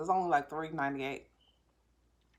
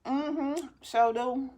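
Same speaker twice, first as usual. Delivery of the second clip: sarcastic agreement; 9 semitones higher, 5 dB louder, much slower than usual. Retold or imitated. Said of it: "they're like".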